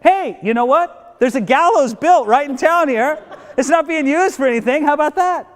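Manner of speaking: eager, high-pitched helpful suggestion; acted bright-idea eagerness; mock-helpful. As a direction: chime in oh-so-helpfully, pitch well up. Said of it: "somebody very helpfully, helpfully says".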